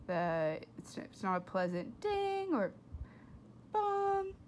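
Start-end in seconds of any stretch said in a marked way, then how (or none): none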